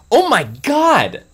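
loud, high gasp of shock; horrified realization. Acted. Shocked discovery.

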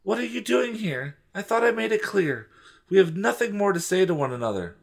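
high-pitched put-on female voice; dramatic girlish rebuff; indignant young woman. Voicing Trisha rebuffing Steven.

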